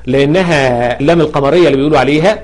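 ordinary delivery

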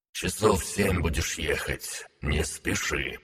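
a distant muffled warning through water; underwater voice. Acted. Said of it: "повторилось предупреждение".

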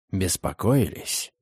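soft, slow, tempting low whisper. A narrator's reading. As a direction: breathe it close and teasing. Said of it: "прошептал он". (искушающий шёпот)

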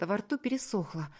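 ordinary delivery, nothing marked

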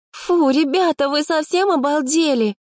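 put-on high, disgusted girlish cry; high indignant girl's voice. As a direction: squeal out your disgust at the food. Girls disgusted at the porridge.

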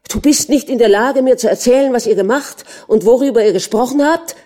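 loud, high, angry outburst of a husband; shouting. A narrator's reading. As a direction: shout the accusation at her. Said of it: "schrie er".

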